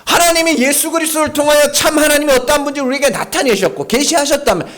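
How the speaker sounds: usual speaking voice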